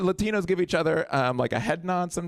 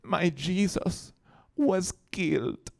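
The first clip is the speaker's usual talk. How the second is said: mock grief; 3 semitones higher, 4 dB quieter, much slower than usual. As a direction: imitate a mother in tearful mourning. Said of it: "And she goes".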